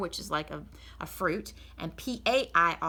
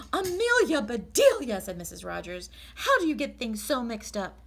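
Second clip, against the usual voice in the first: louder, higher despairing scold; exasperated Mrs Rogers; 4 semitones higher, 6 dB louder than usual. Acted. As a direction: at her wit's end with the maid.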